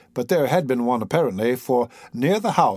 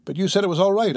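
protesting cry; 6 semitones higher, faster than usual. A narrator's reading. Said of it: "I cried".

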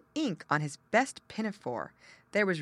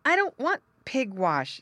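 put-on upset child; 7 semitones higher, 5 dB louder than usual. Child refuses, starts to cry.

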